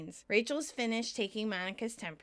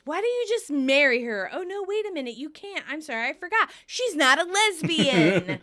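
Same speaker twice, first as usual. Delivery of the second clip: arguing; 6 semitones higher, 7 dB louder than usual. Retold or imitated. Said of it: "Rachel argues".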